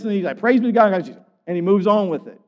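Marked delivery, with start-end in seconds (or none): 0.4-1.2 high, loud burst of praise; exclaimed praise. Acted. Preacher voices Paul moving on from sin, exclaimed praise.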